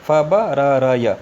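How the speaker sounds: फ ब र र य spaced out, very slow; slow consonant reading. Shown as a demonstration. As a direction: pronounce फ ब र र य one letter at a time. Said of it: "स्वरों को हटा के पढ़ा है". (धीरे-धीरे अक्षर पढ़ना)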